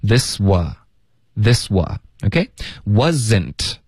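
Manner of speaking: this wa run together without a break, very slow; linked this wa. Shown as a demonstration. Teacher drilling students, slow smooth linking of this wa. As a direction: glide "this wa" as one sound, slowly. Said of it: "不要顿，要连发".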